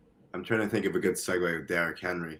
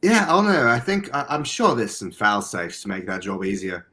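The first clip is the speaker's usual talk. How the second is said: put-on British accent; 4 dB louder than usual. Shown as a demonstration. Podcaster guessing about fail safes, in put-on British. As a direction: stay stuck in amateur British. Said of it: "I'm kinda stuck in it".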